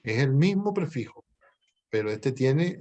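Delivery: ordinary talk, nothing marked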